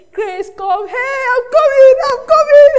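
put-on rising to a high, loud childish call; a toddler eagerly shouting back; excited baby reply. Baby calling out to the house help, high and excited.

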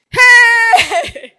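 high, drawn-out yell of fear; hammed-up, very loud "hey" scream; mock frightened shout. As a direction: act out grown men yelling in terror. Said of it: "they shouted for fear".

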